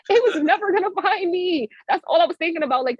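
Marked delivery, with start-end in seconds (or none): 0.1-1.7 high-pitched insistent repeat; emphatic exclamation. Retold or imitated. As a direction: drive the point home high.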